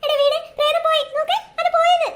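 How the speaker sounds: loud, high excited call; telling her friend the ghost left, loud and excited; excited shout. Acted. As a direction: shout it out in relief.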